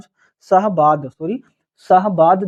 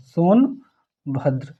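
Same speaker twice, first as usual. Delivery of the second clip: slow dictation; 4 dB quieter, much slower than usual. Shown as a demonstration. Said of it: "teacher dictates district name".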